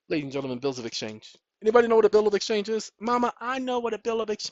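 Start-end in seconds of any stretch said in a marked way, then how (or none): 1.6-2.9 quick quiz question. Acted. Teacher quizzing imagined class. 3.0-4.5 put-on eager child voice.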